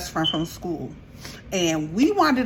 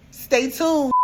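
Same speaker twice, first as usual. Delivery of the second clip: ordinary talk, nothing marked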